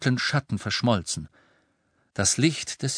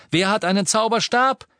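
loud question to crowd; rally the people; calling out; 12 semitones higher, 6 dB louder than usual. Acted. Harry asks crowd.

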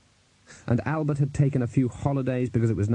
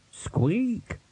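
high, rising little squeak; rat squeak; 9 semitones higher than usual. Acted. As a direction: squeak upward like a tiny rat. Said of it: "The Rat squeaked".

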